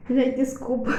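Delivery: choked up. Real, not acted. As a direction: apologise while fighting back tears. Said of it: "Eu fiquei emocionada". (desculpando-se, emocionada de verdade, mais baixo)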